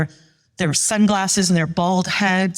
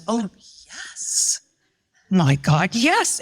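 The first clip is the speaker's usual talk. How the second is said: delighted; much slower than usual. Retold or imitated. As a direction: play an over-the-top thrilled welcome. Acted delighted welcome at password.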